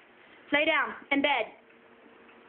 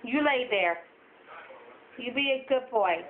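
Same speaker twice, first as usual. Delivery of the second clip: ordinary talk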